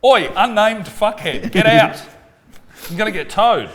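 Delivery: mock angry shout at the unborn baby; mock yell. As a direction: bellow it like an angry dad at the baby.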